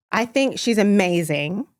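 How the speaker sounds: normal talking voice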